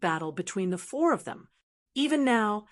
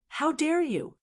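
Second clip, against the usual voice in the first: angry shout; 7 semitones higher than usual. A narrator's reading. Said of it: "Xuandu shouted angrily".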